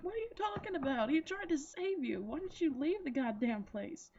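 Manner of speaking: high-pitched indignant outburst, easing down; exasperated scolding. Retold or imitated. Viewer scolds the character who should have fled, exasperated.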